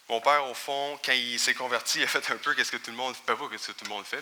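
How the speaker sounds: normal talking voice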